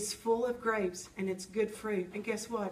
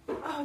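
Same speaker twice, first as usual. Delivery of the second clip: relieved gasp; 4 semitones higher than usual. Retold or imitated. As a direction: catch your breath in relief. Relief on realizing God's goodness.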